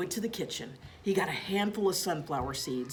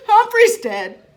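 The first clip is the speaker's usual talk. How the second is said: put-on much higher, louder childlike wail; bursting into tears; 17 dB louder, slower than usual. A narrator's reading. Little girl wails that the hamster is dead. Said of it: "Brenda burst into tears".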